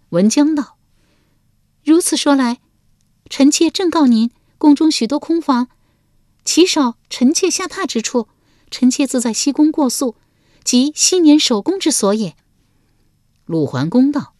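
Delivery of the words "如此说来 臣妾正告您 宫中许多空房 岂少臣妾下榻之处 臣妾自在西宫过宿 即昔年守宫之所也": high-pitched, haughty consort defending herself; Wenjiang's voice. A narrator's reading. Wife fending off her husband's questions, high and haughty. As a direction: raise the voice high and answer him coolly.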